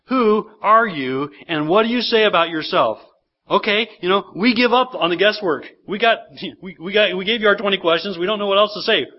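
frustrated questioners. Acted. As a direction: act out fed-up questioners giving up on guesswork. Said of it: "You sense their frustration here".

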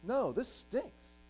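slow glum reply. Retold or imitated. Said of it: "And I'm like".

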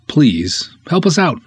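usual speaking voice

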